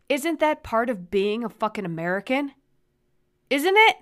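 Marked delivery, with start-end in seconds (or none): 3.5-4.0 high, loud, outraged; indignant shout. Real, not acted. Ranting to her podcast listeners, outraged shout. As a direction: yell it in disbelief. Rant peaks, big jump up.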